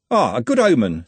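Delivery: plain reading voice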